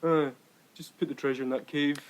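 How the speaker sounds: mock indifference. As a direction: toss it off like you can't be bothered. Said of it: "They just went".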